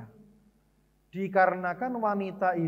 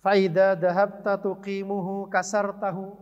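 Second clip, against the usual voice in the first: slow, measured Arabic recitation, louder than his talk; Arabic hadith recitation; 6 dB louder than usual. Shown as a demonstration. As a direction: recite the hadith solemnly and deliberately.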